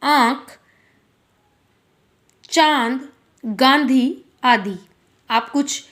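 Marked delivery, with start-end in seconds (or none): 0.0-0.6 आँख with its vowel nasalised; nose-and-throat vowel demo. Shown as a demonstration. Teacher confirming the sound for pupils, आँख with nasalised आँ. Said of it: "दोनों से ही क्या होगा, इसका उच्चारण".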